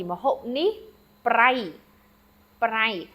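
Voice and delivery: Mahop nih brai with a stressed falling brai; teacher demos the Khmer sentence; Khmer phrase. Shown as a demonstration. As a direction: repeat the Khmer sentence carefully. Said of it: "We learned salty".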